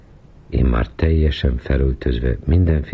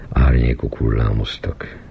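ordinary delivery

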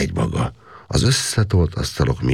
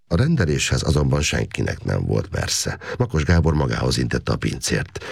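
ordinary delivery, nothing marked

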